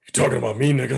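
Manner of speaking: lightly voiced joke demon. Suggesting a trick-or-treater's comeback, in a joke demon voice. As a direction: put on a bit of a demon voice, half laughing. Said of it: "And with them demon voice".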